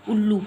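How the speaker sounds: उल्लू with the ऊ loud and punched; stressed oo. Shown as a demonstration. Teacher to class, stressing the ऊ in उल्लू. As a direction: hit the ऊ in उल्लू hard. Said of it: "ऊ की मात्रा जो ज़्यादा स्ट्रेस के साथ".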